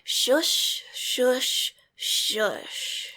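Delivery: silken shushing. Acted. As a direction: hush him gently like a child. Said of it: "She says silkenly".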